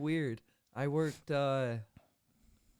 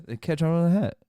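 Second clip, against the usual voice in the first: loud, higher rattled-off repeat; fast, run-on; 5 semitones higher, 13 dB louder, faster than usual. Shown as a demonstration. Fast talker repeating for a puzzled listener, loud and run-on. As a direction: blurt it all as one word. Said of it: "You talk fast".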